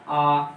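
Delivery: आ held as a long low vowel. Shown as a demonstration. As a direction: draw out आ for students. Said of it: "तो हो गया कौन सा".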